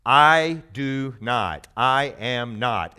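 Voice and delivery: slow, spaced denial with each word weighed; slow emphatic denial. Shown as a demonstration. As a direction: lay out "I do not, I am not" slowly. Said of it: "his statement three times is".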